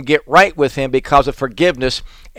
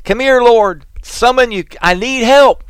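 desperate plea; 8 semitones higher, 4 dB louder than usual. Acted. Psalmist's desperate cry voiced.

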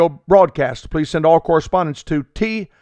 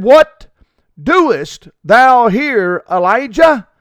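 higher, louder and slow, booming out; a voice calling; 5 semitones higher, 4 dB louder, much slower than usual. A narrator's reading. A voice calls Elijah out of the cave, louder and slow. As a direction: call the question down like a voice from above. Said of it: "there came a voice unto him and said".

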